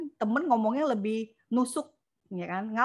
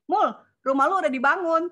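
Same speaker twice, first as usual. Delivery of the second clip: louder, higher "Mul, rumah lu udah dibangun?" across the room; teasing call-out; 6 semitones higher, 7 dB louder than usual. Retold or imitated. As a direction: call out to a friend at a gathering, loud and higher. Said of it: "Ditanya begitu kan".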